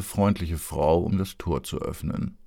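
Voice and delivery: ordinary delivery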